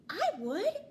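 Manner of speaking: high, loud eager little voice. A narrator's reading. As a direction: pipe up brightly like a tiny star. Little sea star answers eagerly.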